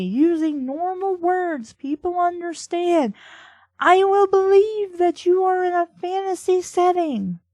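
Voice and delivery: high, strained exasperated outburst; exasperated rant. Real, not acted. Critic telling the writer to write normally, exasperated. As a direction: plead with the writer, fed up and high-pitched.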